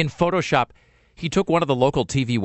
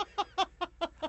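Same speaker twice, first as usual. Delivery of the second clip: squeaky burst of laughter; high laugh; 13 dB quieter than usual. Real, not acted. Laugh before quote.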